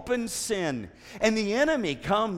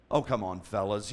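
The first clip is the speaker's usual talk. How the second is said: ordinary delivery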